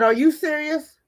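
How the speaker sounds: high, mock-disbelieving question; incredulous therapist. Mimicking the therapist who doubted her, high and incredulous. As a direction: imitate her shocked disbelief. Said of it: "And they said".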